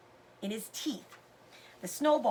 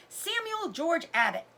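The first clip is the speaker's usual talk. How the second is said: hollering; 6 semitones higher than usual. A narrator's reading. Sister hit by a snowball, hollers his full name. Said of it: "Lizzie hollered".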